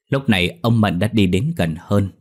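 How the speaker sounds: plain reading voice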